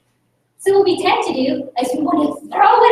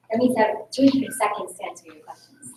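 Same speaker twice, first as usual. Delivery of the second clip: usual speaking voice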